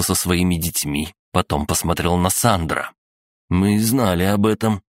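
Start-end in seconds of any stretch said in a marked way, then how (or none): none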